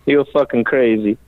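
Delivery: lightly voiced, amused builder; a hint of the Italian master; Dario impression. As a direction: say it with grudging admiration. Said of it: "he looks at me and he goes".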